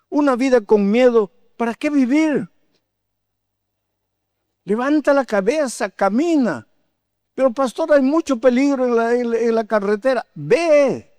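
high, urgent preaching voice; prédica exhortativa. Real, not acted. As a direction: exhort the congregation, pitched well up. Predica con fuerza, exhorta. (prédica aguda y apremiante)

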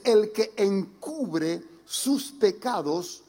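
slow, weighty reading of the verse; solemn scripture reading. Shown as a demonstration. Pastor reads Proverbs to church, slow and solemn. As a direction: read the verse slowly to the congregation, solemnly. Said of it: "El libro de Proverbios veintiocho trece dice".

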